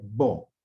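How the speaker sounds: bo said firmly as one strong syllable. Shown as a demonstration. Teacher showing how to sound the syllable, said firmly. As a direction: give bo full force. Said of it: "que va a pronunciar fuerte".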